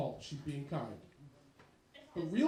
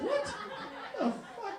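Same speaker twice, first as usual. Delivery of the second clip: shocked disbelief; 14 semitones higher, much slower than usual. Retold or imitated. Shocked reaction to kid's insult.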